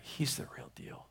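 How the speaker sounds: hushed, breath-only realisation; awed whisper. Acted. Bernard watching Francis through the peephole, awed whisper. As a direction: whisper it, struck to the core.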